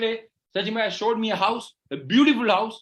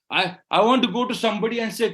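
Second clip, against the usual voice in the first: normal talking voice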